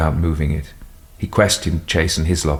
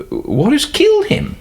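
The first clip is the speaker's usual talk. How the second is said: much higher, shocked question; alarmed question. Acted. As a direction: ask it in alarm. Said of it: "asked Guy".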